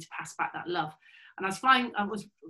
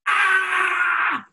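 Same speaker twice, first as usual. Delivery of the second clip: frustrated cry; 11 semitones higher, 9 dB louder than usual. Real, not acted. Exasperated cry at cadet's reply.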